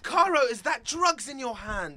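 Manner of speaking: high shrill outraged shouting. Acted. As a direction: scream it out, scandalised. Stacy shouting at drugs.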